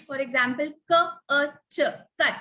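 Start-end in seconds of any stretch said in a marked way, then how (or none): 0.9-1.8 "K, A, T" as three separate short sounds; short vowel "uh" between k and t; segmented phonics demo of "K, A, T"; sounded out letter by letter. Shown as a demonstration. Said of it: "if the word is".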